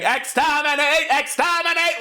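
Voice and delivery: high, chanted Dalek screech. Retold or imitated. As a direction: shriek it like a Dalek. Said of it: "was running down saying".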